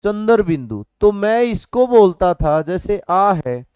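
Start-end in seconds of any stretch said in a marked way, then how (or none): none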